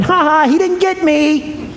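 put-on high, gloating child in hide-and-seek; mock-childish, drawn-out teasing; giggling kid. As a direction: crow it like a kid who got away. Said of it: "giggling and going".

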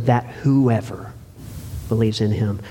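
preacher pointing out the key word, stressing "whoever". Shown as a demonstration. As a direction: linger on "whoever" so it lands. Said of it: "Maybe you've never emphasized that word before".

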